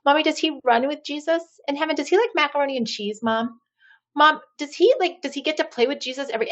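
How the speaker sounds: imitating a three-year-old. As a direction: pipe up like a curious toddler pestering mom. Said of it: "this sweet and sassy little three-year-old".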